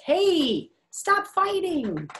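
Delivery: put-on high, drawn-out childlike scolding; little girl scolding. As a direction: play a little girl breaking up a fight. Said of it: "And the girl says".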